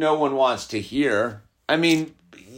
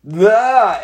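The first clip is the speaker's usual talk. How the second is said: dismissive blah; 9 semitones higher, 6 dB louder than usual. Retold or imitated. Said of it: "I just go".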